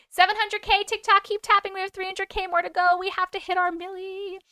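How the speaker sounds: high-pitched rallying push; excited hype. Real, not acted. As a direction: urge the viewers on in a high, eager voice. Hyping viewers to keep tapping likes.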